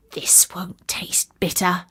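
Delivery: whispered to himself; whispered aside. Acted. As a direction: breathe it out under the breath. Tailor muttering to himself.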